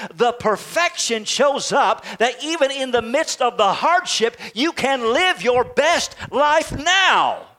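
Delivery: rousing sermon delivery, stretched landings on key words; forceful preaching. Acted.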